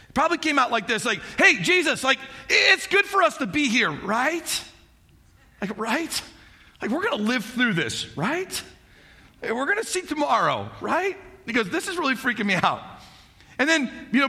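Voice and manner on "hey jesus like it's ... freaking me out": higher, jittery nervous chatter; nervous Peter blurting. Retold or imitated. Peter nervously blurting to Jesus on the mountain, higher and jittery. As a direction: babble it out like a scared man. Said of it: "it came out more like this".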